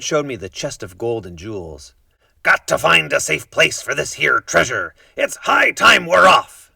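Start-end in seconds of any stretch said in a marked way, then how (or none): 2.4-6.7 pirate announcing. A narrator's reading. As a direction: proclaim it to the whole ship. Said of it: "he announced".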